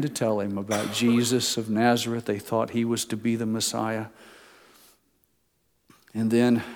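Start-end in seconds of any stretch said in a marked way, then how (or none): none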